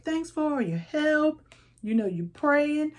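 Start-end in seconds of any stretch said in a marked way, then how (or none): 0.0-1.4 grateful flag. Retold or imitated. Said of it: "the flag is like".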